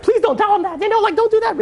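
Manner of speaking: very high-pitched mother's plea; squeaky, anxious imitation; panicked pleading. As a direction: imitate a frantic mother begging him to stop.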